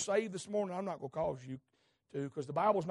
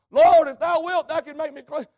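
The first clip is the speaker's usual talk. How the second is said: louder, higher cry of a begging leper; pleading leper; 9 semitones higher, 15 dB louder than usual. Acted. Preacher voicing the leper begging Jesus, loud plea. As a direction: cry out to the Lord for healing.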